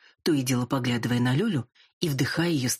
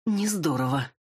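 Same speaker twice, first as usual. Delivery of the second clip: grumbling. A narrator's reading. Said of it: "пробурчал ящер тот".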